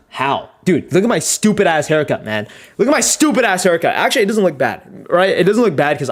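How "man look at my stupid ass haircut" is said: usual speaking voice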